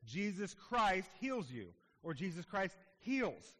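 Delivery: ordinary delivery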